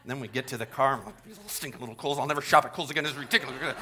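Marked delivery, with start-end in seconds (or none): 1.2-3.8 low, soft private griping; mock muttered irritation; acted grumbling. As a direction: mutter complaints once out of earshot.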